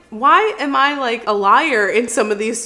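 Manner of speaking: ordinary delivery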